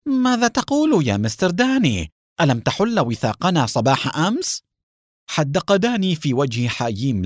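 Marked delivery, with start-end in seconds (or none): 0.1-4.6 high-pitched, incredulous opening question; baffled. A narrator's reading. Haim questioning Mr. Dani's denial, baffled. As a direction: thrown by what he hears. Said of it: "ظهرت الحيرة على وجه حاييم وهو يقول".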